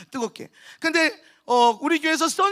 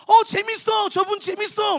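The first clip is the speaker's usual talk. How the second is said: high, louder burst of mock delight; delighted fan praise; 7 semitones higher, 4 dB louder, slower than usual. Children praising the fun preacher, high excited gushing. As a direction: gush like a pleased young audience. Voicing fans of his entertaining preaching.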